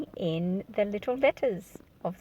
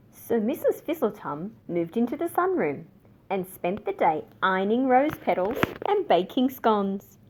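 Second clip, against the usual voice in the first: usual speaking voice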